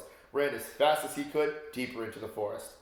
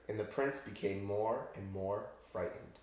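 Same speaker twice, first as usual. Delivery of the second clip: usual speaking voice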